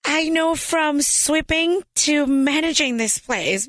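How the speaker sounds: nervous applicant faking total confidence in an interview; confident. Retold or imitated. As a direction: deliver it like you own the place. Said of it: "but I looked so confident".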